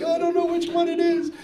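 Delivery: high whiny sob imitation; put-on child's crying voice; mock crying. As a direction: wail it like a tearful kid. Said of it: "And she's crying".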